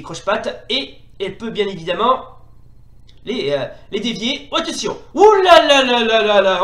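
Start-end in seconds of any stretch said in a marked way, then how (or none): 5.1-6.6 loud, high, tumbling ooh-la-la; big alarmed gamer reaction; startled cry. Real, not acted. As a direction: cry out in a long falling run. Caught by attack.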